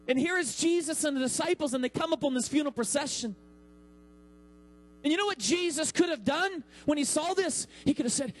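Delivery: ordinary talk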